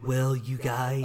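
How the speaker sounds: slow, drawn-out Reagan voice; a hint of old Reagan, slow; Reagan impression. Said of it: "It's like".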